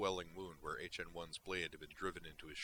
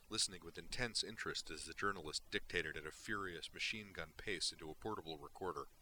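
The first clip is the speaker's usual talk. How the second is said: ordinary delivery, nothing marked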